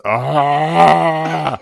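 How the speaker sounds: long, loud, high wail; drawn-out mock cry of pain; imitated agonized groan. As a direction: mimic a man suffering in a stall. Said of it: "the guy goes".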